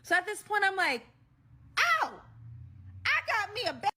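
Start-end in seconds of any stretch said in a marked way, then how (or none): 2.0-3.9 sudden high yelp of shock, pitch jumping up. Retold or imitated. Telling friends how she reacted to his crime, high startled blurt. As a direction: blurt it out, caught off guard.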